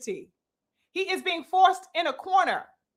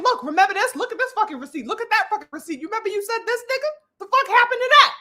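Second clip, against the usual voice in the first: higher-pitched, incredulous challenge; incredulous confrontation; 6 semitones higher, faster than usual. Acted. Fans show the boxer his old words, incredulous. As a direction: voice climbing as you demand an explanation.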